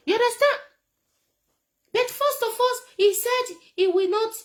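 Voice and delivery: high, loud excited emphasis. Real, not acted. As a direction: push it out with mocking glee. Excited mocking aftermath.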